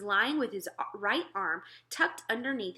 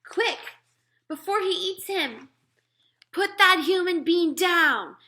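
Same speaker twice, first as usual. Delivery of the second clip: much higher, louder urgent shout; giant shouting; 10 semitones higher, 9 dB louder than usual. Acted. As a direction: order the giant to drop the man. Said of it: "the B F G shouted".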